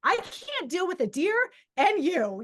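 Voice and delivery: high, drawn-out overwhelmed plea; acted-out frightened exasperation; retold scared protest. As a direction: protest in mock panic, stretching it out. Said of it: "I'm, like, scared".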